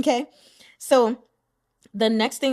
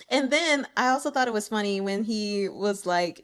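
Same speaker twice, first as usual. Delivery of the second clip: ordinary talk, nothing marked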